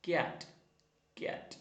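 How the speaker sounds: ordinary talk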